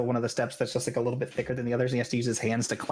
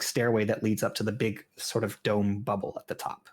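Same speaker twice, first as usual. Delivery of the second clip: normal talking voice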